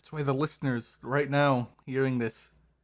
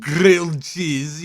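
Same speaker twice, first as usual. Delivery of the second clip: slow mock-royal British accent; hammed-up royal British, drawn out; posh British royalty; 3 semitones higher, 5 dB louder, much slower than usual. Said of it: "say it in old royalty".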